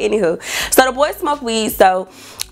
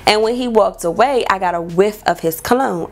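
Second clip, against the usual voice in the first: ordinary talk, nothing marked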